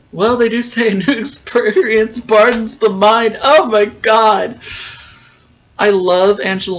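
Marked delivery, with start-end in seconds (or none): 0.1-4.6 real laughter breaking through louder words; laughing. Real, not acted. Laughing at the scene.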